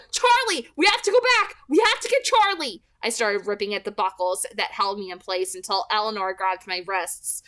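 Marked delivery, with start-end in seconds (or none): 0.1-2.8 high, loud desperate yell. A narrator's reading. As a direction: shout to turn the car around. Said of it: "and then I yelled".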